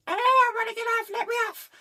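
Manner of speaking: very high, loud mock whine; desperate wail. Imagining being stuck on a cruise ship, high desperate whine. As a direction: wail like someone trapped aboard. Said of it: "I'd be going".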